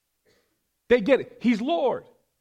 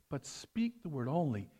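softly murmured, as at communion; mumbled prayer; 4 semitones lower, 8 dB quieter than usual. Shown as a demonstration. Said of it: "Some of you hear us mumbling up there".

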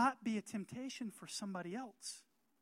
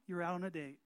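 usual speaking voice

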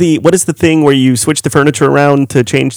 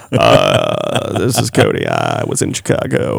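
very low creaky vocal fry; Cody vocal fry impression; 4 dB quieter than usual. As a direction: drop as low as you can, creaking. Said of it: "a lot of vocal fry there".

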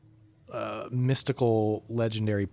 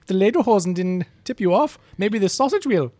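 put-on jokey German accent, much higher and louder; 9 semitones higher, 5 dB louder than usual. Joking about being German.

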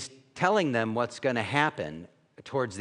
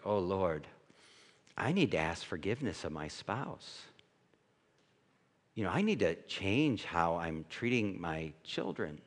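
lower, softer, humbled; contrite prayer; 7 semitones lower, 7 dB quieter than usual. Retold or imitated. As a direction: confess it quietly before God. Confessional prayer, low soft.

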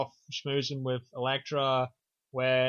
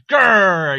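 very loud "grrr"; hammed-up roar of frustration; mock angry growl; 5 semitones higher, 13 dB louder than usual. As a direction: burst out with a cartoon growl of rage. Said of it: "'cause he's mad".

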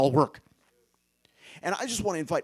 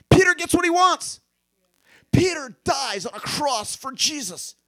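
much higher and louder, slowed; preaching shout; 11 semitones higher, 10 dB louder than usual. Real, not acted. Preacher shouting the climax to the congregation. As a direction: proclaim it with full force. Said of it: "preaching climax".

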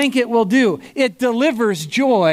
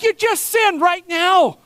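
much higher and slow, triumphant; gotcha; 10 semitones higher than usual. Retold or imitated. Pastor catches the gentleman, high triumphant gotcha. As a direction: nail him with it, slow and high. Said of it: "I'm like".

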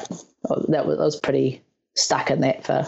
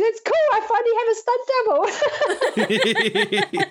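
much higher, giddy and laughing; gleeful joke; faster than usual. Retold or imitated. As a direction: crow with delight. Joking about finally having double.

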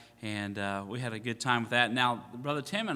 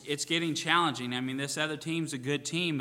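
normal talking voice

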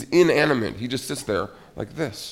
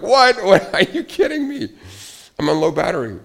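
much higher and louder, half-laughing disbelief; mock panic; 8 semitones higher, 8 dB louder than usual. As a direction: yelp at the dying phone like it betrayed you. Retold phone at four percent.

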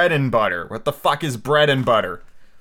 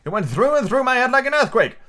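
high-pitched royal outburst; indignant king; 10 semitones higher, 3 dB louder than usual. A narrator's reading. Poor king threatening the noisy messenger, high indignant cry. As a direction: scold in a high, rising voice. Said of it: "cried the poor king, jumping up and shaking himself".

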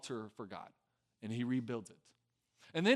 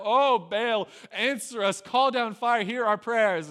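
high, loud mock pleading to a god; hammed-up chant-like cry for an answer; 8 semitones higher, 9 dB louder, slower than usual. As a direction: call out to the heavens in mock desperation. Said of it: "they're crying out".

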